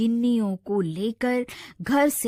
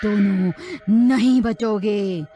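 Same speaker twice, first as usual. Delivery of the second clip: witch voice; 3 semitones higher, 3 dB louder than usual. Acted. Witch threatening the two frightened brothers, menacing cackle. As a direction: threaten them like a witch, then laugh.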